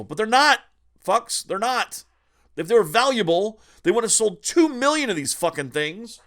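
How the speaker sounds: loud, high angry rant. Real, not acted. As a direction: rant at the scalpers. Ranting at scalpers.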